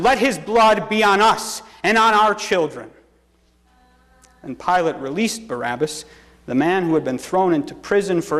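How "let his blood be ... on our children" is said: raised, higher-pitched collective answer; crowd's cry. A narrator's reading. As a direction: call it out for all the people. All the people answer Pilate.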